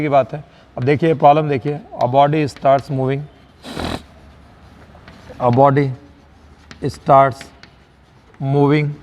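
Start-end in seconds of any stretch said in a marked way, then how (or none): 5.4-8.9 long pause after each word or two; measured, broken-up dictation. Shown as a demonstration. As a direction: dictate slowly for students writing it down.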